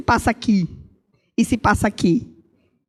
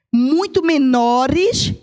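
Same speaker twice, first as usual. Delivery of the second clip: stretched slow, lingering on muito; drawn-out emphasis; much slower than usual. Real, not acted. Preacher lingers on the point for the congregation, drawn out. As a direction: draw the words out to make the point.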